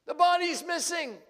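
high, loud, panicked announcement; alarmed cry. Acted. Ladies rushing in to the disciples, loud panicked cry. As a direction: burst in shrieking the news. Ladies bursting in with bad news.

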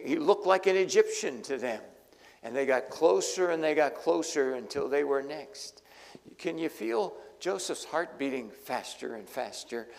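normal talking voice

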